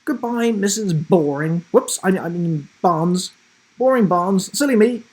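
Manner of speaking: high, loud, mock innocent; cheeky grandma. Grandma mocks the teacher, loud high teasing. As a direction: tease the teacher gleefully.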